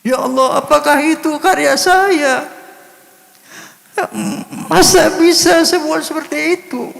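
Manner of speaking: high, strained, near-sobbing voice; tearful crying. Retold or imitated. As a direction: relive the moment he wept at hearing it. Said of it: "Ya, saya menangis". (suara tinggi menahan tangis)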